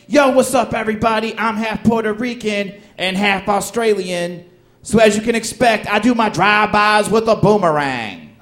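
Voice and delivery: high, loud hype delivery; hammed-up comedian working to a punchline; formulaic stand-up comic. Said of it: "the first guy came on stage, he's like".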